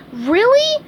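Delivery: high, loud squeak of surprise; astonished Really; wide-eyed surprise. A narrator's reading. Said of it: "his eyes opening wide".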